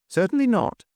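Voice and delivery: high-pitched indignant echo; affronted, incredulous question. A narrator's reading. Chairman answering the hotel keeper, indignant. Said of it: "answered Mister Audley indignantly".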